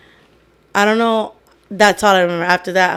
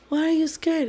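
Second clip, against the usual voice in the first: put-on high, soft little-girl voice; 7 semitones higher, 7 dB quieter than usual. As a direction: ask it softly, imitating a small child. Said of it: "I was talking like a little".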